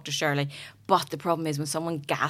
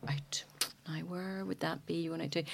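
quiet mock questioning; 13 dB quieter than usual. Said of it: "And I'm like".

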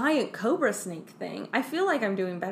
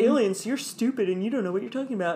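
mock sneering boyfriend; faster than usual. Boy toy mocks David.

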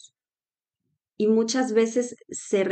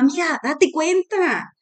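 friend's wake-up call; 8 semitones higher, 3 dB louder, slower than usual. Acted.

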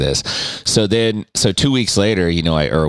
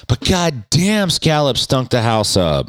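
ordinary talk, nothing marked

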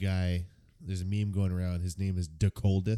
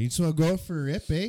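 fast, much higher Canadian bro, eh; 9 semitones higher, 4 dB louder, faster than usual. Pretend. Imitating old-time Canadian guys, fast high mock accent. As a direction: hype up a buddy like a Canuck. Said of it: "guys walking around back then going".

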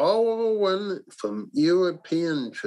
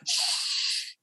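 hiss; 4 dB quieter than usual. Acted. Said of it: "and he hissed".

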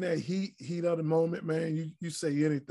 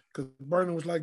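ordinary delivery

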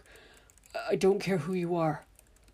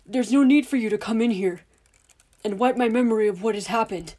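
loud, higher, heated insistence; angry shouting; 5 semitones higher, 11 dB louder than usual. Acted. Father shouting back while interrupting the official. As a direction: hammer the point home, shouting. The men pushing, Dudley cutting in.

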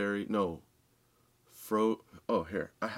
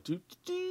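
high, dreamy hummed doo doo doo; trippy hum; 7 dB quieter, faster than usual. Retold or imitated. Mimicking trippy floating.

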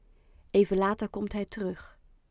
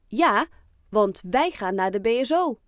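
tough; 2 semitones higher, 8 dB louder than usual. Acted. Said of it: "zegt Oscar stoer".

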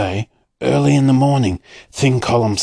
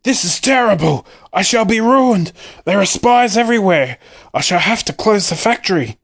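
shouting in despair; 8 semitones higher than usual. A narrator's reading. Willy Wonka, ruined by spies, shouting in despair. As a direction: wail it like a man whose business is finished. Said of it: "tore his beard and shouted".

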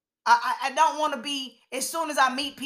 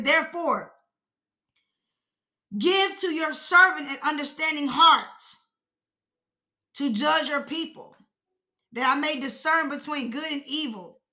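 raised, slow, deliberate Bible reading; scripture reading; 5 semitones higher than usual. Acted. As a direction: read the prayer out with long pauses. Reads scripture aloud.

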